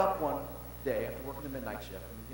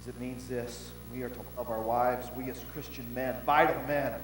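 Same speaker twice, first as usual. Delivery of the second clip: usual speaking voice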